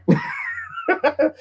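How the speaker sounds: high, light chuckling laugh. Real, not acted. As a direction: laugh at your own joke. Laughs at own joke.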